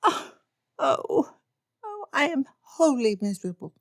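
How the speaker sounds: high, hammed-up wail; mock despair. Lydia laments to Papa, hammed-up high despair. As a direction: overplay the misery.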